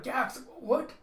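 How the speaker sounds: high, loud startled squawk; alarmed yelp. Acted. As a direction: yelp as you're yanked upward. Minion hoisted by tentacles, alarmed.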